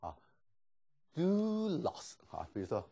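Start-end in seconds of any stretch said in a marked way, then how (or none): 1.1-2.1 "do" drawn out high, then dropping to a low "loss"; high-low pitch accent. Shown as a demonstration. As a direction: stretch DO and glide it from high to low. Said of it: "就给它好像高低". (do先高后低,拉长)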